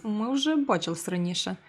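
ordinary talk, nothing marked

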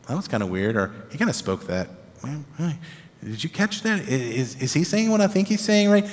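half-whispered suspicion between friends; hushed puzzled aside. Retold or imitated. Disciples murmuring quietly to one another about Jesus.

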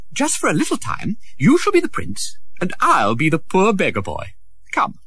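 high, excited scheming; a prince inviting Tom to trade clothes; eager prince. Acted. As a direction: propose the swap with glee.

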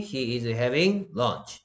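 very slow, deliberate English example; slow model sentence. Shown as a demonstration. Teacher reading an example sentence to students, slowly. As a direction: spell the sentence out word by word.

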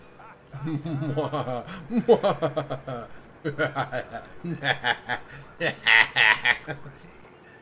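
loud maniacal villain laugh; evil laugh. Pretend. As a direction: cackle like a cartoon villain. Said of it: "I gotta get me a maniacal laugh".

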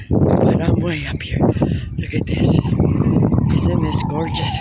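breathy, panting after the climb; out of breath. Real, not acted. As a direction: push words out between gasps. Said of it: "Out of breath".

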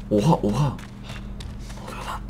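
hushed whisper of disbelief; slow stunned whisper; astonished whisper. Real, not acted. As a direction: whisper it in shock. Sees something strange, hushed.

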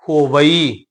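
ਹੋਵਈ as ho-va-ee, ending drawn out; syllable demonstration. Shown as a demonstration. Teacher spells out the word, careful three-syllable ਹੋਵਈ. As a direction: keep the separate "ee" at the end of ਹੋਵਈ. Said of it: "ਹਾਹੇ ਉੱਪਰ ਹੋੜਾ".

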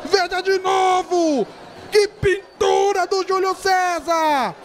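high, slow, shouted goal celebration; explosive goal commentary. Real, not acted. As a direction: keep yelling the goal, stretched out.

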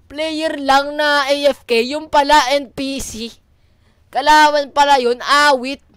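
loud, high, drawn-out disbelief; shocked realisation. Real, not acted. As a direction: exclaim slowly after a fright. Realising after the scare.

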